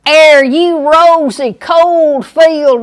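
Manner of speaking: slow, high, loud Southern drawl with "Air" for are; slow broad Southern reading of a Faulkner line; Southern accent. Shown as a demonstration. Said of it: "Here's a just perfect Southern".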